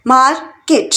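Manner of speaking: Market in two separate beats; split into syllables. Shown as a demonstration. Teacher counting out two syllables for learners, syllable by syllable. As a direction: pause between मार and केट. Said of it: "market में भी टू syllable".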